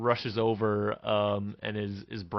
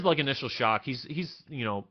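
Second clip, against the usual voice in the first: ordinary talk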